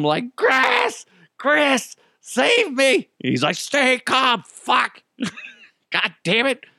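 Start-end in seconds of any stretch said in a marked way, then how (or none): 0.4-3.1 very high-pitched mock scream for help; panicked retold scream. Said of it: "I'm, like, screaming back at him". 3.6-6.6 high, strained mock yell; brother's retold shout. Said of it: "my brother shouts out".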